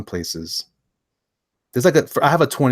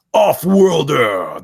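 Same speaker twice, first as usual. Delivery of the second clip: high, loud, slow title call; dramatic title announcement; 6 semitones higher, 9 dB louder, much slower than usual. Pretend. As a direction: herald it with drawn-out grandeur. Announces next title theatrically.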